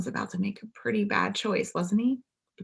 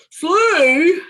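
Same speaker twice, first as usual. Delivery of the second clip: high, much louder gleeful exclaim; 12 semitones higher, 11 dB louder than usual. A narrator's reading. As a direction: burst out with the idea. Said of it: "he exclaimed".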